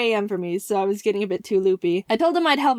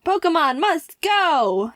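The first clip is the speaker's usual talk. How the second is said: high, slow triumphant catchphrase; slogan proclamation; 6 semitones higher, slower than usual. Acted. YouTuber seeing the Pokemon slogan used too, triumphant. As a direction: sing out the slogan's words, stretched and proud.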